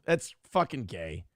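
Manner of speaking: ordinary talk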